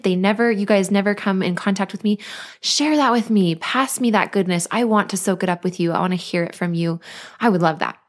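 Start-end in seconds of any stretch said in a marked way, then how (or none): none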